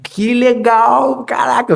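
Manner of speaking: overjoyed Irmão, que legal; retold delight. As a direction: play your own thrilled reaction. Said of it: "eu fiquei todo alegre".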